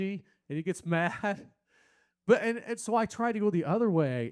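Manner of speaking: ordinary talk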